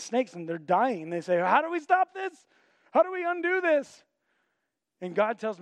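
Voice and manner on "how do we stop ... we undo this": high, loud, panicked pleading; desperate plea. Acted. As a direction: beg for a cure in a panic. Bitten Israelites beg Moses.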